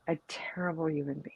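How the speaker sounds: drops to a hushed whisper. Real, not acted. Whispering her verdict on the man to the hosts. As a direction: lean in and whisper it like a secret.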